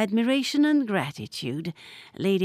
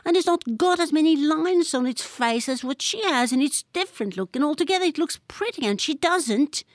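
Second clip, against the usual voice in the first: put-on stubborn, higher boyish insistence; dogged; 8 semitones higher than usual. A narrator's reading. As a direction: plough on stubbornly. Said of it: "he went on doggedly".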